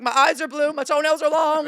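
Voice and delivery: high, loud, blurted-out details; eager oversharing. Retold or imitated. Caller telling the hotline man anything he wants, eager oversharing. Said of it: "telling him anything he wants to know".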